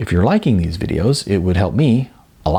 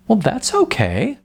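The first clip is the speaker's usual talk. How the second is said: bright, much higher cheerful reassurance; a coach cheerfully waving away a worry; 13 semitones higher than usual. Acted. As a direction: brush it off with a happy lift. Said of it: "said Coach Bobby cheerfully".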